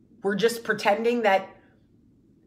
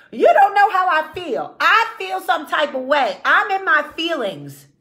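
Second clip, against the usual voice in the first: higher and louder, a hammed-up wounded rant; mock emotional outburst; 6 semitones higher, 6 dB louder than usual. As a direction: play the woman demanding her feelings count. Mocking women's emotional outbursts.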